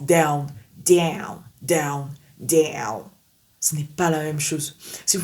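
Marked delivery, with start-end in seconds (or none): 0.0-3.0 down with English D, tongue behind teeth. Shown as a demonstration. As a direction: repeat down with English D. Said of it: "Vous voyez la différence?".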